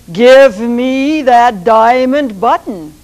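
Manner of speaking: slow, loud demand from the Sultan; stern Sultan. Acted. Sultan demanding the rooster's button, slow and loud. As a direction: Sultan lays down the order.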